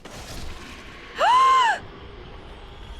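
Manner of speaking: very high, airy gasping shriek; real high frightened gasp. Real, not acted. Sudden game scare.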